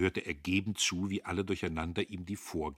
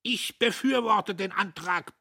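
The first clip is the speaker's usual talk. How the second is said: high, loud military roar; bellowing; 13 semitones higher, 8 dB louder than usual. A narrator's reading. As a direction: bellow your support. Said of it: "brüllte der Major".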